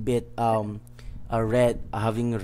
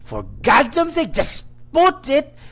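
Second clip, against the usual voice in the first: much higher, louder furious shout, slowing down; a raging chef erupting at his helper, furious shout; erupting in rage; 8 dB louder than usual. Acted. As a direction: explode at the girl. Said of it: "he erupts saying".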